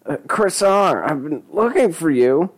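high-pitched, anxious stalling; nervous young man. Acted. Narrator voices nervous Denny to dealer.

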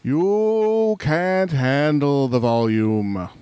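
slow, drawn-out mock-dramatic line; hammy courtroom-drama delivery; mock movie outburst. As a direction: stretch every word theatrically. Mock movie line thrown back.

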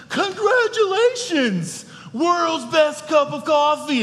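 high, bright-eyed gushing at the diner; excited Buddy the Elf. Retold or imitated. Pastor imitating Elf congratulating the diner, very high and excited. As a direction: squeal it out like Buddy bursting through the door. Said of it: "So excited, he runs in and says".

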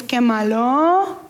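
slow, drawn-out mock reproach; imitated little-girl complaint. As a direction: stretch it out like a pouting child. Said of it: "llegamos con Dios y decimos".

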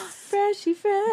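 high, slow sing-song; silly high voice. Pretend. Echoes in silly voice.

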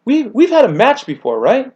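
high, loud eager quote; a keen fan recalling the match; retold eager voice; excited Dean Lister. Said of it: "He goes".

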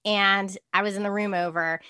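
normal talking voice

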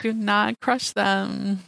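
slow, half-joking plea; slow pleading. Acted. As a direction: draw out each word to plead. Pleading for the tulips.

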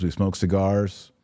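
ordinary delivery

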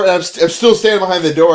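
ordinary talk, nothing marked

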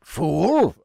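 hammed-up captain taking offence; affronted pirate captain. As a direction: throw it back as an insulted pirate. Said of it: "stops and turns and goes".

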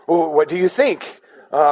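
usual speaking voice

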